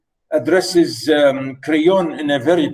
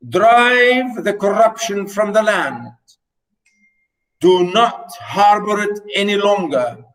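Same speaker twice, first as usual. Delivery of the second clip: higher, slow, solemn oracle command; dramatic reading; 5 semitones higher, slower than usual. Shown as a demonstration. Teacher reading Apollo's decree aloud to students, dramatic reading. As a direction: intone the god's decree weightily. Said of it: "so here we say".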